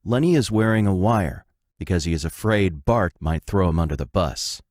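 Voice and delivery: usual speaking voice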